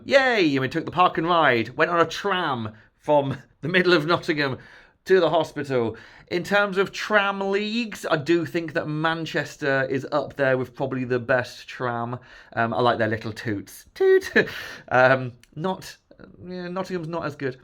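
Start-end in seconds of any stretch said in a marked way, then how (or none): 0.0-0.5 higher, louder little cheer; a happy yay; excited cheer. Real, not acted. Celebrating arriving in Nottingham. 13.9-14.3 very high, loud imitated tram horn; tram toot.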